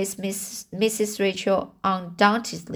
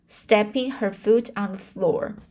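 ordinary delivery

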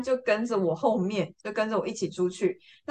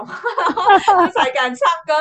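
laughing while speaking; 8 semitones higher, 8 dB louder, slower than usual. Real, not acted. Laughing at her own fear.

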